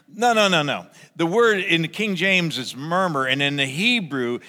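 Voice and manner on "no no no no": ordinary delivery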